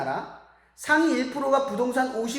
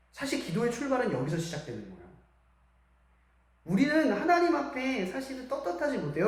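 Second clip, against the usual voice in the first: normal talking voice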